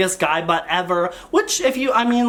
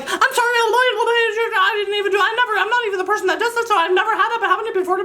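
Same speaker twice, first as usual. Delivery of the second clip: flustered apology; 11 semitones higher, much faster than usual. Retold or imitated. Reading his apology text.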